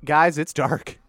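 usual speaking voice

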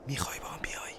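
very soft unvoiced whisper. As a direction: barely breathe the question. Intimate whispered invitation.

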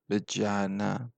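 murmured. A narrator's reading. Zorba murmurs to himself as he decides, soft and slow. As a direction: drop it quietly and slowly, resigned. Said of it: "زمزمه کنان گفت".

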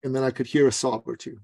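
ordinary delivery

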